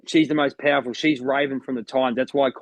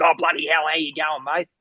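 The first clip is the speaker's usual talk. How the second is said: Steve Irwin impression; 3 semitones higher than usual. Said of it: "the Steve Irwin impersonations".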